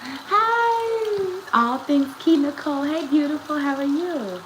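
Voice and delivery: high, bright hello to a viewer; excited greeting. Real, not acted. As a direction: squeal a big hi. Greeting a viewer who joined.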